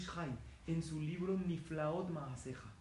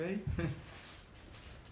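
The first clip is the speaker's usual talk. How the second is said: risa breve; 3 semitones higher than usual. Real, not acted. Laughs after Titanic reveal.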